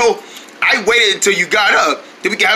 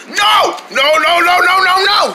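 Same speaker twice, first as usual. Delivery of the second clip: shouting no; 9 semitones higher, slower than usual. Acted. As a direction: yell no repeatedly. Refusing food, shouting no.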